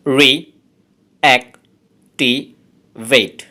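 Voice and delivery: Reactivate broken into slow, separate syllables; teacher dividing reactivate into syllables. Shown as a demonstration. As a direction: spell out reactivate one syllable at a time. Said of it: "এই ওয়ার্ডটাতে আছে চারটি syllable".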